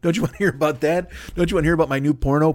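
ordinary talk, nothing marked

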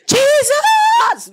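a piercing high shout of Jesus from the back of the hall; screamed Jesus. Retold or imitated. Preacher mimicking a dramatic worshipper screaming at the back, piercing high shout. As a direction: cry out like a dramatic worshipper in revival.

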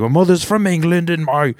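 put-on British accent. Retelling the girl's bit, imitated British accent. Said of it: "British or something".

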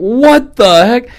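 high, loud, slow "what the heck"; retold flip-out of amazed surprise; stunned disbelief. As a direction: stretch it out, floored by the coincidence. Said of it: "I flipped out".

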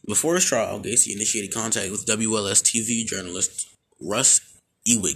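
ordinary talk

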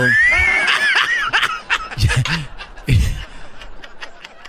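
breathless high laugh burst; wheezing laugh. Real, not acted. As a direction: crack up with an airy wheeze. Host cracks up.

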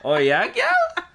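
very high, loud, drawn-out mock reply; hammed-up cocky comeback. Man playfully answering a woman's threat, hammed-up cocky comeback. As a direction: squeal it slowly and loudly, playing it up.

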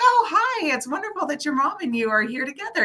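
high, bright burst of delight; squealed hello at church; excited greeting. Retold or imitated. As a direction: greet her like a long-lost friend. Greeting the woman and her daughter.

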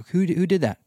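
sudden high startled "Who, who did that?"; asked sharply, caught off guard; Jesus turning in the crowd, startled; startled question. Retold or imitated. Jesus feels power leave him.

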